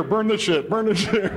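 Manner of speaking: mock addict chanting; frantic addicts. Addicts running around burning papers.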